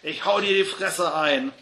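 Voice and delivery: loud threat to start a punch-up; bellowed threat. A narrator's reading. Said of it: "brüllte der andere".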